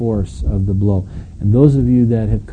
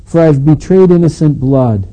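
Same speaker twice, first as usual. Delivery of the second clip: loud, raised-pitch confession; remorseful outburst; 4 semitones higher, 7 dB louder than usual. Retold or imitated. Judas confessing to priests and elders, loud remorseful outburst. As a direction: proclaim the guilt forcefully. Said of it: "he was seized with remorse".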